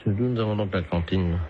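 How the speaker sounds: low, muffled official on the phone; deep disguised voice. Acted. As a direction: drop the voice low and stiff. Prank caller disguises voice.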